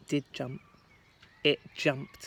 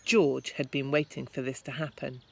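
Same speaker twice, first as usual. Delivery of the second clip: plain reading voice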